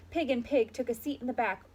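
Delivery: ordinary delivery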